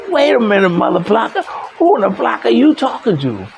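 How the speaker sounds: high-pitched indignant comeback, acted out; angry challenge, retold without full volume. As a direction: re-enact squaring up to an opponent. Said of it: "never seen me raise my voice".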